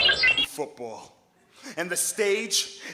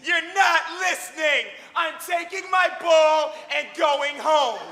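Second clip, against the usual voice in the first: high, loud tantrum yell, slowed on the second line; shouting; 7 semitones higher, 6 dB louder than usual. Acted. Scott shouting at the other kids who ignore his rules. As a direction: yell it like a kid grabbing his ball. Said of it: "he'd shout at the top of his lungs".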